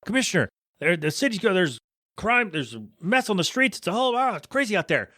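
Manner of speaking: high, rushed panicked officer; mock panic. Officer bursting in on the commissioner, panicked. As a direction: blurt it out like a frantic cop. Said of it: "the officer is freaking out".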